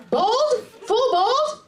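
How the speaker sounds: high-pitched incredulous echo. Acted. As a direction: throw it back in mock shock. Mock shock at audience member's answer.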